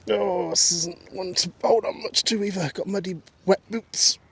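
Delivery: effortful climbing. Real, not acted. Walker climbing with muddy wet boots, strained. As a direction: say it while straining over a stile.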